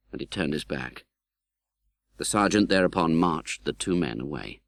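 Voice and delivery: ordinary delivery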